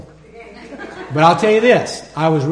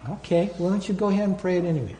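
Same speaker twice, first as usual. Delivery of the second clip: quick, much softer, placating answer; unruffled reply; 2 semitones higher, 8 dB quieter, faster than usual. Retold or imitated. The Lord answering an angry man's denial, unruffled. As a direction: drop the volume and calmly brush the protest aside.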